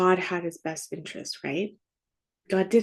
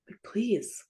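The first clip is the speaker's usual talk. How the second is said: breathy whispered please, barely voiced; softly whispered pleading; 7 dB quieter than usual. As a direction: whisper it like a last desperate prayer. Echoes Jesus' plea, whispered.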